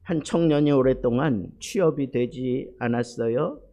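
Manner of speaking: ordinary talk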